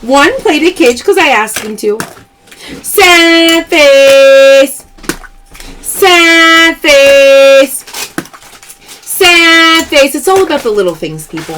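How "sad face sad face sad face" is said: louder, drawn-out call; playful loud callout; loud catchphrase call. Real, not acted. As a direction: announce it to the chat. Live sale callout.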